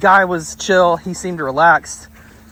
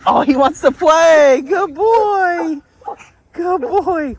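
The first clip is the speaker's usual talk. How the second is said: high, drawn-out squeal of delight; excited dog-talk; 12 semitones higher, 4 dB louder, much slower than usual. Real, not acted. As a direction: gush loudly over a playful dog. Excited over playful dog.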